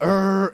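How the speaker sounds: big rumbling "er" like an earthquake; loud stomach growl. Retold or imitated. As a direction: imitate a huge belly rumble. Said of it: "It's loud".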